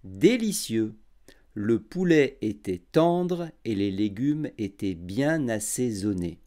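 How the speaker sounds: every syllable clear and unhurried; over enunciating. Shown as a demonstration. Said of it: "j'articulerai bien et je parlerai lentement".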